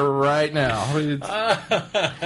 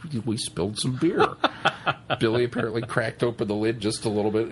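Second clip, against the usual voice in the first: ordinary talk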